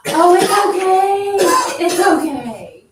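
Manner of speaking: slow, high, loud cooing; pat-pat comfort, stretched out and overdone; hammed-up there-there to a crier; mock soothing. Shown as a demonstration. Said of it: "I'm gonna pretend like you're crying".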